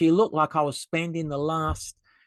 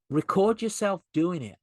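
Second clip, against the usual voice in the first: normal talking voice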